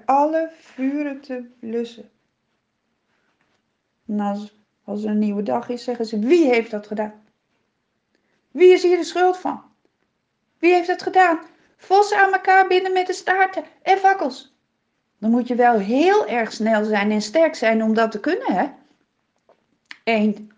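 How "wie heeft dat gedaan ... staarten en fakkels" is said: higher, louder, fast outraged questions; angry demand. A narrator's reading. Philistines ask angrily who burned their fields, higher and louder.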